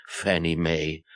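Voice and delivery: ordinary delivery, nothing marked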